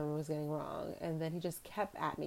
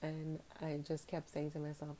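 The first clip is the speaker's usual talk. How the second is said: ordinary talk, nothing marked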